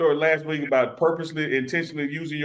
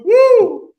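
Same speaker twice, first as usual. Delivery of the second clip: high, loud whoop of delight; 12 dB louder than usual. Real, not acted. Hyping Chuck's bare arms for the ratings, a loud high whoop. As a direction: whoop for him.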